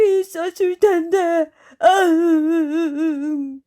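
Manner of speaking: wailing, sobbing beast; tearful tiger. A narrator's reading. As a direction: lament through sobs, ending in a long high wail. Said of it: "갑자기 눈물을 철철 흘리지 뭐야". (엉엉 우는 호랑이의 한탄)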